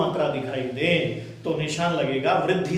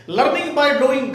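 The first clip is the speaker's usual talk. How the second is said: Learning by doing said loud and very slow, each word spaced; loud, slow classroom slogan; slogan, slowly stressed; 7 semitones higher, 5 dB louder, much slower than usual. Shown as a demonstration. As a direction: drag out Learning by doing like a motto. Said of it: "करके सीखिए".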